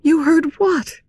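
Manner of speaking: high-pitched startled heroine; alarmed question. A narrator's reading. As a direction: ask it in high disbelief. Christine alarmed at what he heard.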